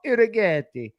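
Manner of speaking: the name Urighetti in a hammed-up Italian accent; a quick, badly faked Italian accent on the name Urighetti. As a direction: roll Urighetti out like a cartoon Italian. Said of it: "that was a bad Italian voice right there".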